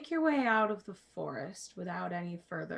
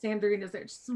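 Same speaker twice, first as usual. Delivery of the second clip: ordinary talk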